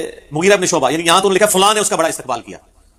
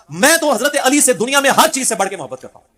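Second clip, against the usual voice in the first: higher-pitched, heartfelt avowal; fervent declaration; 6 semitones higher than usual. Retold or imitated. Man telling the companion of his love, fervent and higher. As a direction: quote a devotee's raised, ardent declaration.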